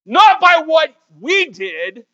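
slow, high, loud emphasis; emphatic climax. Real, not acted. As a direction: land it slowly with force.

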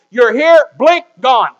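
high, loud pulpit delivery; forceful preaching. Real, not acted. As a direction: drive it home to the congregation with punched key words.

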